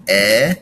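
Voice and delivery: eh said short and loud; short E vowel sound. Shown as a demonstration. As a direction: clip the short E as eh. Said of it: "that short E sound".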